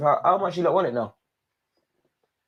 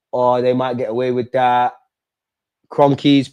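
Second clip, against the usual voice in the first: ordinary talk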